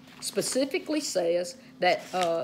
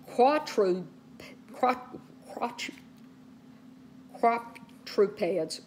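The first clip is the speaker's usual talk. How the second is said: real stumbling over a hard word; tongue-tied; slower than usual. Real, not acted. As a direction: break off and retry "quadrupeds" haltingly, syllable by syllable. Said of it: "I'm tongue-tied today".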